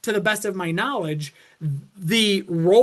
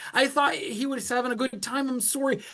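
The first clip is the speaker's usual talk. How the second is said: put-on Canadian accent; 6 semitones higher than usual. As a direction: meekly apologize over and over like a sweet Canadian. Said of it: "so cute Canadian accent".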